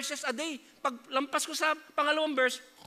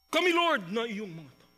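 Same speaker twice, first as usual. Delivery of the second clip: pious prayer voice. Retold or imitated. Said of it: "talagang parang banal na banal".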